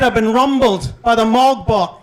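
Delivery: ordinary talk, nothing marked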